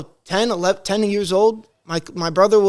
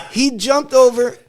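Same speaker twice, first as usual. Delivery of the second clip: ordinary talk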